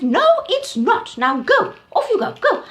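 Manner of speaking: very high, loud dismissal; Auntie Giraffe. Acted. Auntie Giraffe sending the elephant away, high and loud. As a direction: send him off firmly like a bossy aunt.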